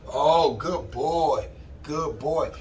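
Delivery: slow drawn-out praise for a good dog; praising dog. Real, not acted. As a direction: reward the dog tenderly. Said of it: "praising dog".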